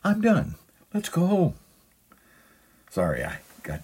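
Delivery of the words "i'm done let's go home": very high, loud mock wife voice; hammed-up high voice imitating a wife; imitated wife. Wife to husband after shopping, high mock voice. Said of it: "your wife may say to you".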